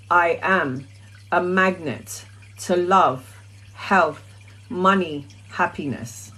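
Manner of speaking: slow, measured chant with pauses; repeating an affirmation slowly, word by word; slow mantra recitation. Shown as a demonstration. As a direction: intone each word like a daily affirmation.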